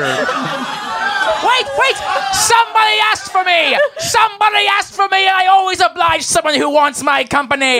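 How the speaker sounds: shouting eagerly to be picked; high gleeful yelling; excited shout. Acted. Player voices eager volunteer shouting.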